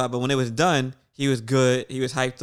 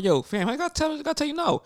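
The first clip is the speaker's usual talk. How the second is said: high-pitched, exasperated reply; mock exasperation; 11 semitones higher, 4 dB quieter than usual. Telling a scared kid the truth, mock exasperated. Retells pleading with frightened child.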